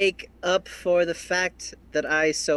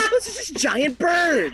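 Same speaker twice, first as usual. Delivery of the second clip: high voice breaking with laughter; real laughing. Real, not acted. Player joking about monsters in the woods, laughing helplessly. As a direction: squeal it through the giggles. Cracking up at own joke.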